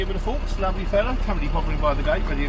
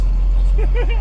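loud laugh; 9 dB louder than usual. Real, not acted. Laughs at his own joke setup.

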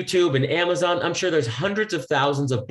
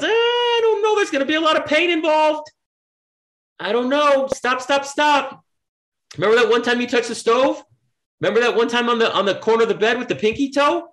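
much higher, whiny nagging inner voice; brain's scared inner voice; 10 semitones higher than usual. Acted. Brain warns the dreamer off, high whiny fretting. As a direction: play your fearful brain talking you out of it. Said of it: "Your, your brain goes".

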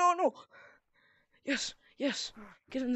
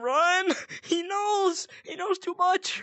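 high, loud panicked yelling; mock panic; 10 semitones higher, 6 dB louder, slower than usual. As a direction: shout it like you're fleeing for your life. Fleeing the tagger in game.

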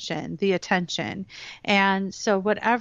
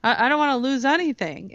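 puzzled rising questions, then quick, higher protest; alarmed subconscious; 3 semitones higher than usual. Acted. The subconscious balks at losing weight, mock alarmed protest. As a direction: play a baffled, mock-indignant inner voice. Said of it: "the subconscious goes".

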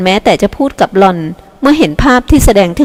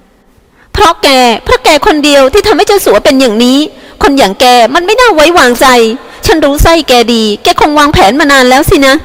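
shouting accusation; 6 semitones higher than usual. A narrator's reading. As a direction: snap it at him like she means every word. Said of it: "ลีเมหันมาตวาดใส่". (ตวาดเสียงสูงอย่างโกรธจัด)

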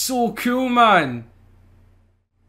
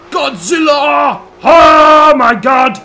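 drawn-out, much louder thrilled yell; excited shout; 7 semitones higher, 11 dB louder, much slower than usual. Real, not acted. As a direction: roar as the car launches. Launching the GT-R.

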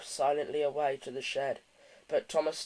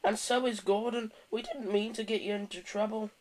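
higher-pitched sheepish character voice; muttered apology; 6 semitones higher than usual. A narrator's reading. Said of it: "he muttered".

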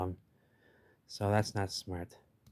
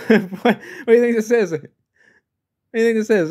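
much higher and louder, laughing through it; mocking shark voice; 13 semitones higher, 12 dB louder, faster than usual. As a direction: tease the divers as a cocky shark. Voicing the sharks to divers.

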